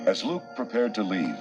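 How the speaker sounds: usual speaking voice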